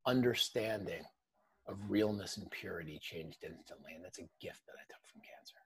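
soft, sincere voice fading to near whisper; hushed reflection, trailing into a whisper; heartfelt and hushed. Real, not acted. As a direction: let it sink quietly to almost nothing. Heartfelt close of his cancer story.

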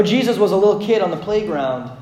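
ordinary delivery

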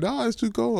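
very high, loud retold yell. Yelling at the paramedic to stay warm, very high shout. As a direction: yell the refusal to the roof. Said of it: "And I started yelling".

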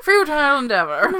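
high, loud, hammed-up delivery; character voice. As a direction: proclaim it like a silly character. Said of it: "in a character voice".

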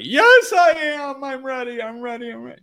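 high wailing protest, fading from loud; hammed-up cry that starts as a shout; mock desperate insistence. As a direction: imitate a frantic seeker pleading. Acts out desperate seeker insisting.

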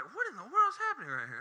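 high-pitched mock bewilderment; hammed-up disbelief; acted astonishment. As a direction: exclaim it as a stunned onlooker. Astonished disciples voiced in the boat.

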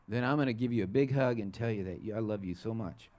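put-on soft, low loving promise to a child; softly reassuring parent, trailing down low; tender. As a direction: drop the threat into gentle affection. Mock threat turns into loving promise.

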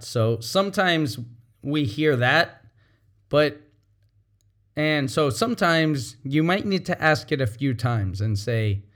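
ordinary delivery

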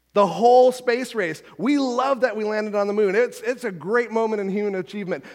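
normal talking voice

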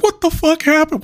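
high-pitched shocked question; imitated alarmed mom. Mom arriving to find the injury, imitated alarm.

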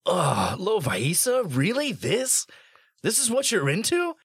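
high, incredulous questioning; exasperated groan into high-pitched disbelief; disgusted disbelief. Acted. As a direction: play appalled, pitch climbing on each question. Character appalled at Lovaisa's company.

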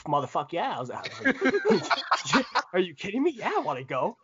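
retold fired-up acceptance; eager shout. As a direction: jump at the chance to fight.

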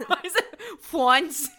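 "fawns" drawn out with a New York vowel; New York accent. Real, not acted. Laughing at own word for baby deer, New York accent. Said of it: "I'm suddenly from New York".

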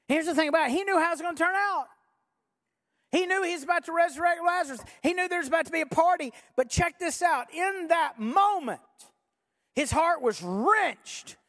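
high, urgent preacher's build, slowing at the end; impassioned preaching. Real, not acted. Preacher tells crowd of Jesus' heart, impassioned preaching. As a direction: drive it forward, then stretch the key words.

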